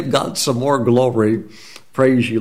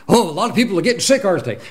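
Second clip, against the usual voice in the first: quick, gossipy complaint rising high; a grumbler spreading gloom, lightly voiced; mock grumbler; 7 semitones higher, much faster than usual. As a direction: rattle off bad news like a gossip. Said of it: "people that are just grumbling".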